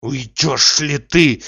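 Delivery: slow, pressured demand; tightly held anger; through clenched teeth. A narrator's reading. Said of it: "сжав зубы, заговорил Обломов, напирая".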